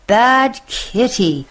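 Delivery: mom scolds the cat, slow and high; shouted scolding. Acted. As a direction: snap at the naughty kitty. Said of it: "shouted Mom".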